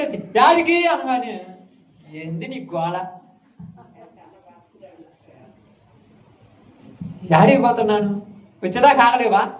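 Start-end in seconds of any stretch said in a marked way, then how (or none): 0.4-0.9 high, loud "యాడికి?" called after someone leaving; a sudden shouted "యాడికి?". Retold or imitated. Family member calling after leaving man. 2.1-9.5 annoyed retort. Retold or imitated. Annoyed man retorts to caller.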